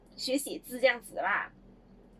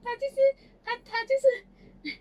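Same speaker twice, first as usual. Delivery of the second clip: amused grandpa; 10 semitones higher, 3 dB louder than usual. Retold or imitated. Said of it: "公公就在那边下来讲".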